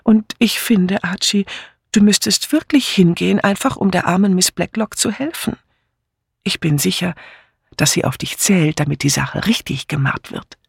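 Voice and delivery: hohe, süßlich schmeichelnde Ehefrau; schmeichelnd. A narrator's reading. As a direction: umschmeichle ihn mit hoher Stimme. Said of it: "Schmeichelnd dämpfte sie nun die Stimme". (säuselnd überredend)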